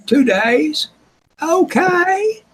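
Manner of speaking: put-on squeaky high voice for the young guy; real high voice. Retelling the mechanic's assistant from the show, squeaky high. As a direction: pitch way up like a nervous kid. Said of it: "Real high voice".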